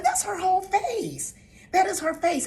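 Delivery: high, loud, insistent reprimand; exasperated grandmother telling off; emphatic scolding. Retold or imitated. Retelling her scolding of grandson.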